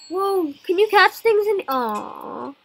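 ordinary talk

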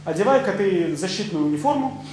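ordinary talk

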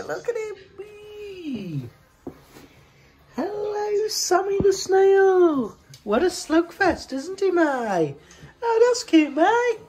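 high, sing-song pet talk to a snail; cooing at a pet. Real, not acted.

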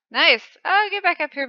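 normal talking voice

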